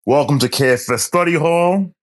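booming ringmaster welcome, pitched high; grand entrance announcement. Acted. Host opens the show.

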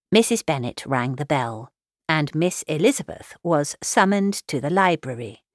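usual speaking voice

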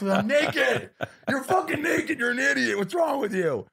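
very high, hammed-up wail; squeaky mock sobbing, laughing at himself; mock bawling. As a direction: blubber at yourself in comic despair. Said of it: "I'm just bawling".

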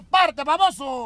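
mocking scoff. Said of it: "se cae tu hijo".

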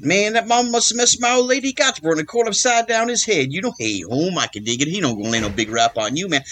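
fast slangy seventies jive patter; jive talk. Retold or imitated. Doing the Airplane jive talk for the casting director. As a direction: rattle it off like the jive guy from Airplane. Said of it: "I knew all the jive talk".

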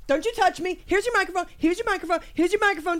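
high, agitated protest; flustered, indignant outburst; raging out. Pretend. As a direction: repeat it in a worked-up huff. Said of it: "raging out in my mind".

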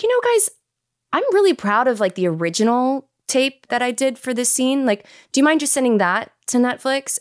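high-pitched, coaxing ask; retold tentative request. Retold tentative favour asked of producers.

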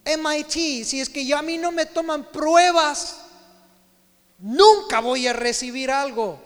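ordinary talk

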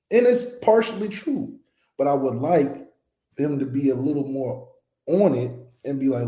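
ordinary talk

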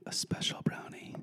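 hushed whisper, very soft; whispered confession. Real, not acted. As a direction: whisper it like a secret. Confessing to eating a special brownie.

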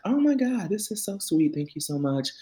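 high, sweetly gushing gratitude; gushing thanks. Retold or imitated. Passenger thanking the driver for a kind prediction, high gushing. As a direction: gush it high, easing down toward the end.

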